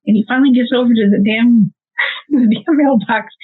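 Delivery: usual speaking voice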